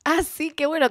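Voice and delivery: high, drawn-out polite "oh great"; feigned interest. Retold or imitated. Drummer humouring a drunk fan, feigned interest. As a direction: humour them, imitating fake interest. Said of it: "le chupa tres pitos y medio".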